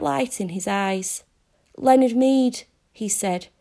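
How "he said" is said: plain reading voice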